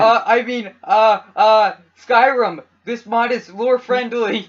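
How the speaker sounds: slow, emphatic proclamation; loud cover-up. Pretend. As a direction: announce it loudly over the objection. Loudly covering an in-joke slip.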